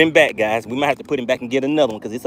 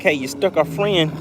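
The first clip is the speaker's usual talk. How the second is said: fish voice; 6 semitones higher than usual. Retold or imitated. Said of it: "They're like".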